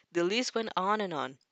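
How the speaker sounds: plain reading voice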